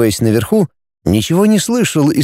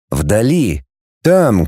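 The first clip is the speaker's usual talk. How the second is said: plain reading voice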